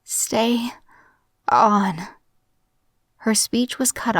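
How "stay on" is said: halting, strained words far apart; failing mother. A narrator's reading. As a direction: push out each word with effort. Mother can barely speak.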